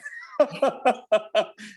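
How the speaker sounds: breaks into a high-pitched laugh. Real, not acted. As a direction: laugh at your own joke. Laughs at own joke.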